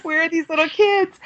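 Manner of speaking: high, eager mock excitement; jacked up. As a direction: ask in an overexcited high voice. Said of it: "I was a little jacked up".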